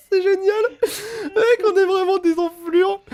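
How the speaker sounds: high, loud words through laughter; laughing. Real, not acted. Laughing at their prank.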